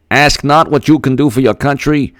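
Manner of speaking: high, badly faked Boston accent; JFK. Quoting the inaugural to test himself, bad Boston accent. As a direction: orate in a hammed-up Boston accent, pitched high. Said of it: "I can't do the Boston accent".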